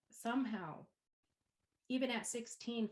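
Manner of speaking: ordinary talk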